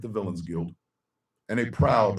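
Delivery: ordinary delivery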